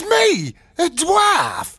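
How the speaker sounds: high-pitched affronted questioning; outraged, high and incredulous; indignant disbelief. Acted. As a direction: throw it back, appalled. Offended by offer of dwarf role.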